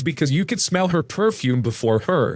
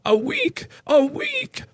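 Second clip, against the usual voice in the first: high, incredulous echo, climbing higher; scandalized squawk, much higher pitch; outraged disbelief; 14 semitones higher, much slower than usual. A narrator's reading. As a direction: repeat it as if you can't believe it. Outraged customer repeats the wait.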